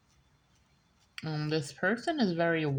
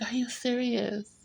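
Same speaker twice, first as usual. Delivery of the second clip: incredulous; 7 semitones higher than usual. Retold or imitated. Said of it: "I'm like".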